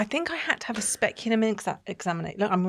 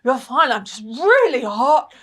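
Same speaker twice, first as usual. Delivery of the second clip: flustered cover; 5 semitones higher, 5 dB louder, slower than usual. Retold or imitated. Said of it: "I was like".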